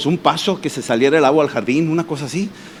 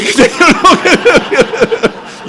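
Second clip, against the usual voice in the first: high bursts of nervous laughter; relieved laughter; 9 semitones higher than usual. Real, not acted. Telling how he almost died, relieved laughter. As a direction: laugh off a close call.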